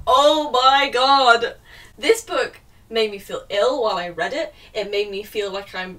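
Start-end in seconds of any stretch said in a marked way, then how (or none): 0.1-1.5 very slow, louder oh my god; drawn-out exasperated groan. Real, not acted. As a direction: drag it out, fed up. Finishing the book.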